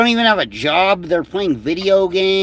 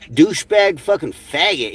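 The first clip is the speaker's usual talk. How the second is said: ordinary delivery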